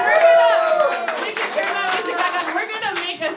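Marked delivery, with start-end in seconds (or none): 0.0-1.1 loud. Real, not acted. Host cheering after applause.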